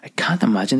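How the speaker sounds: ordinary delivery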